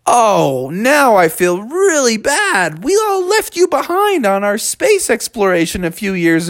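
guilty apology. Acted. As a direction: wail a little, feeling bad. Steve regrets leaving Mikey behind.

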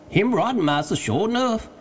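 high-pitched dialect voice; Jupiter, assenting servant. A narrator's reading. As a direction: call the answer down to your master. Jupiter answers Legrand.

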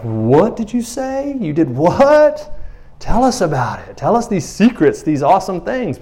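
high, excited mock astonishment, opening drawn out; amazed disciples. Imagining amazed disciples' reaction.

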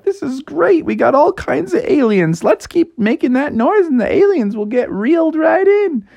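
very high, gleeful character voice; high-pitched eager enthusiasm; excited sidekick. Acted. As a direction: cheer on the plan in a high voice. Bucky delighted by all the aliens.

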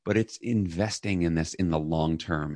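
ordinary talk

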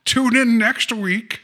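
high, slow Mid-Atlantic sign-off; Mid-Atlantic accent. Acted. Co-host closing a mock serial episode, Mid-Atlantic announcer. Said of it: "this Mid-Atlantic accent that doesn't really exist".

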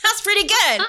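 excited. Real, not acted. Excited praise after chug.

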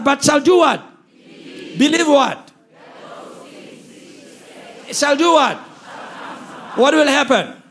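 loud preacher prompting the congregation; loud, insistent church call-and-response; rousing call-and-response preaching. Real, not acted. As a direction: fire each question out for the crowd to answer. Prompting congregation to finish verse.

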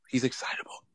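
hushed, voiceless whisper; whispered aside. Pretend. Co-host confiding about excitable host, whispered aside. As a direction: whisper it under the breath. Same remark repeated as an aside.

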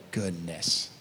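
low, hushed exclamation; hushed reaction. Acted. Congregation reacting to Jesus's hard words, hushed. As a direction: let it drop very low and soft. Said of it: "and we go".

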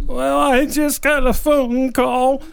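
high, slow, put-on old man; hammed-up elderly man on the phone. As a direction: play a worried old man, drawn out and high. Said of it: "in my best old man voice".

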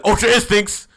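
high, loud anime battle cry; mock power-up shout. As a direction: shout it like a special move. Announces hero's sudden power-up.

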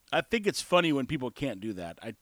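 ordinary talk, nothing marked

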